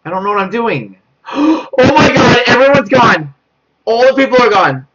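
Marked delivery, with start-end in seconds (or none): 1.3-1.7 a sharp high gasp of fright; startled gasp. Real, not acted. Lights go out, jump scare. 1.8-4.9 higher, louder near-crying alarm; frightened cry. Real, not acted. Everyone disappeared on camera.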